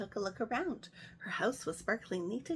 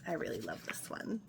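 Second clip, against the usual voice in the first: soft whispered confession to the viewers; whispered aside; 5 semitones lower, 6 dB quieter than usual. Real, not acted. Storyteller whispering to her viewers before reading. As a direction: lean in and whisper it.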